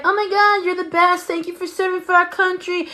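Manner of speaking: high mock adoration; gushing relatives. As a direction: coo over him like adoring aunties. Family thanking him for service.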